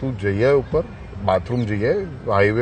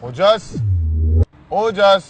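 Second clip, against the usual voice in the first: calling out; 6 semitones higher, much slower than usual. Real, not acted. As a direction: call a name up the building. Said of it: "બૂમ પાડ સરખી".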